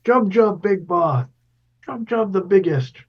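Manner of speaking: high-pitched goofy orc voice. Acted. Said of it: "do your best orc impression".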